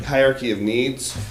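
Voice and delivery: normal talking voice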